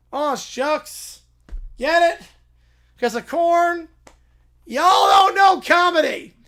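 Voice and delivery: hammed-up catchphrase shout. Applicant shouting his corn joke at the farmer. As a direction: yell the punchline at the farmer.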